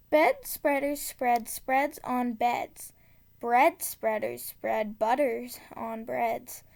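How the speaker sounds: usual speaking voice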